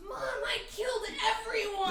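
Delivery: high, loud gleeful yell; mock triumphant boy's shout; imitated child's shout. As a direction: holler it like an excited kid to mom. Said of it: "with which he shouts".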